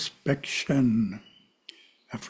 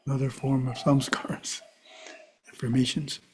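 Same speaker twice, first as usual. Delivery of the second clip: whispered aside; 7 dB quieter than usual. Real, not acted.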